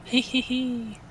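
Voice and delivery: little high giggle; snickering. Real, not acted. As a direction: snicker at the victory pose. Giggling at victory pose.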